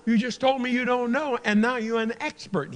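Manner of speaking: high, loud sarcastic jab; indignant retort. Retold or imitated. Patient scolds the doctors, loud and sarcastic. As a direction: catch them in the contradiction.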